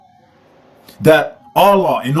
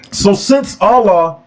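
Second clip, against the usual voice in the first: normal talking voice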